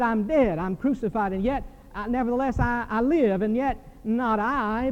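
preaching. Acted. Preaching Paul's words again.

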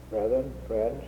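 ordinary delivery

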